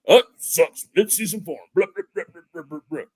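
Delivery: high, loud caricature of a griping fan; jeering mock-fan outburst. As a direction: imitate whiny online fans, ending in high-pitched blah-blah babble. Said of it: "I'm not gonna do my fan voice".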